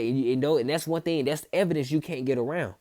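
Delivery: usual speaking voice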